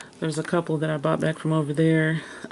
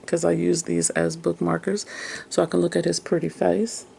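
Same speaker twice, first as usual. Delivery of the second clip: ordinary talk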